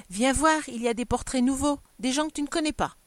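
put-on lively child. A narrator's reading. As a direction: chirp it eagerly, like a little girl showing off. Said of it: "sa vivacité reprenant le dessus".

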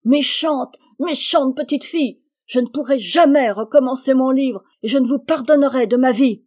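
high, loud, grief-stricken shout; anguished cry. A narrator's reading. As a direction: scream it out in despair. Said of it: "Jo criait dans un délire de douleur". (cri de douleur aigu et fort)

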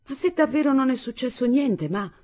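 high-pitched, uneasy questioning; suspicious and uneasy. A narrator's reading. Daughter doubting mother that nothing happened, suspicious and uneasy. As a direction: press her with growing suspicion. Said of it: "con tono sempre più insospettito e inquieto".

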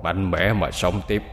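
tender blessing. Acted. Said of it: "cất tiếng nói".

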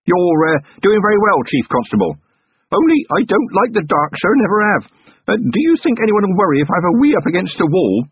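put-on a scared young copper trying to sound brave; Feeney calling out nervously behind Vimes in the dark; nervous young constable. A narrator's reading. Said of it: "came the nervous voice of Feeney".

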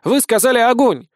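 high, loud shouted reply; shouting back. Acted. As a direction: yell the order back. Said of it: "крикнул в ответ".

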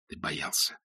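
breathy near-whisper admission; quiet confession. A narrator's reading. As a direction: confess it to yourself, barely voiced. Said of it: "признался он себе".

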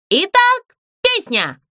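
high, slow showman announcement; a little puppet announcing herself grandly; puppet Zlyunya voice. Acted. Puppet announces herself to the ghost, high and grand.

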